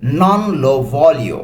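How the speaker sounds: "Non lo voglio" drawn out word by word; teacher modelling "Non lo voglio" at half speed; slow model phrase. Shown as a demonstration. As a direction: spell out "Non lo voglio" very slowly for the learner. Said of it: "একসাথে কি বলতে পারি?".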